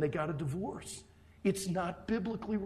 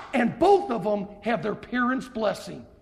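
loud preaching; 4 semitones higher, 8 dB louder than usual. Acted. List item preached loudly.

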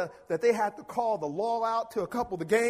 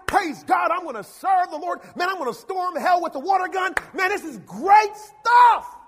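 loud, hammed-up churchy enthusiasm; mock excited youth; 6 semitones higher, 8 dB louder than usual. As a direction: play the fired-up kid at a youth rally. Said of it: "we can get all excited".

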